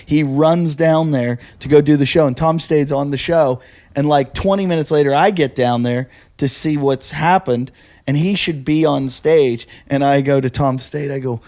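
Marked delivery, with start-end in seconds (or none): none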